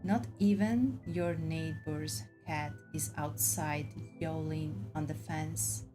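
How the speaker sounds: ordinary delivery, nothing marked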